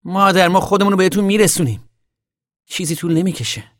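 high, loud cry to mother; shout. Acted. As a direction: call out to reassure her. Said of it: "تام بانگ برداشت".

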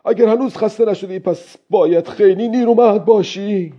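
put-on high-pitched old fisherman calling to the fish; calling out loud. A narrator's reading. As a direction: raise the voice high and address the fish aloud. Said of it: "با صدای بلند گفت".

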